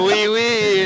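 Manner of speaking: hammy French oui oui; mock French accent. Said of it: "stupid French hats".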